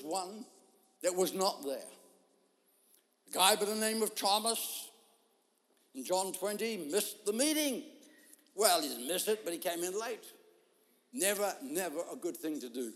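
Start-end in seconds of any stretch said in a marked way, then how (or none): none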